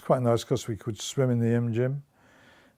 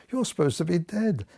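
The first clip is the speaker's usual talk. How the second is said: retold challenge, pitched higher; challenging; 6 semitones higher than usual. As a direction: imitate an accusing challenge without raising your voice. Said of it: "in a very challenging way".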